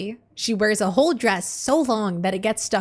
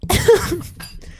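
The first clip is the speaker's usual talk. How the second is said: high breathy laugh breaking out; burst of laughter; 10 semitones higher, 5 dB louder than usual. Real, not acted. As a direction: let the laugh escape. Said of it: "I'm trying not to laugh".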